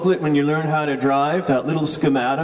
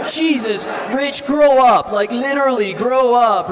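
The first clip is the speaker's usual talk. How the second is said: high, exasperated scolding, louder on "grow up"; 7 semitones higher, 4 dB louder than usual. Retold or imitated. Driver telling crying Rich to grow up, exasperated. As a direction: scold a friend in acted exasperation.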